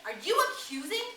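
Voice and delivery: sudden furious high shout; shouted in rage. Acted. Jack to Ralph, feeling accused, furious shout. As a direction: fling the question back in a rage. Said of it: "suddenly Jack shouted in rage".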